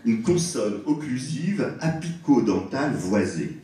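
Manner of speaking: technical term repeated slowly, "une consonne occlusive apico-dentale voisée"; deliberate repeat. Shown as a demonstration. Lecturer repeats a mouthful of jargon for the audience, slowly and deliberately. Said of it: "Je répète".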